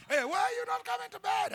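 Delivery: high mock call to bed; a hint of an impatient wife. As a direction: call to the husband from the bedroom. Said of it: "wife calling".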